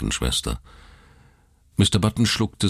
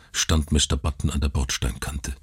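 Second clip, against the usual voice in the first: ordinary delivery, nothing marked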